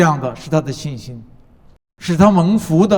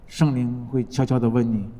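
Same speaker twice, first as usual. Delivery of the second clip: softer and lower retelling of 悄悄地问你; quieter; 5 semitones lower, 5 dB quieter than usual. Shown as a demonstration. Preacher softening as the Spirit speaks, quiet. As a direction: drop to a soft, lower voice on 圣灵会悄悄地问你.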